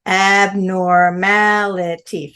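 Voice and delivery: "Abnormality" said slowly, each syllable set apart; five slow syllables. Shown as a demonstration. Teacher to the class, spelling out Abnormality in slow syllables. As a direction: stretch "Abnormality" out into five clear beats. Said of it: "Five syllables".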